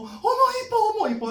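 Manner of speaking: very high falsetto cooing; exaggerated squeal of admiration; gushing sightseers. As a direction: imitate people raving over pretty scenery. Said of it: "막 사람들이랑 막 들로".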